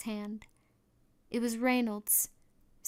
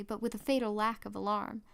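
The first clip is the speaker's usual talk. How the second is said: usual speaking voice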